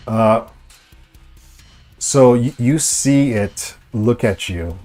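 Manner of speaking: normal talking voice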